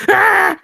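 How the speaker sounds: loud, very high elf squawk; piercing acted scream; creature shriek. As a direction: yelp like a small creature in a grip. Shaken elf cries out.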